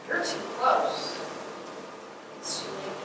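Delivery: hushed ominous warning. Acted. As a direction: let the warning fade into a low hush. Ominous warning closing the foghorn passage.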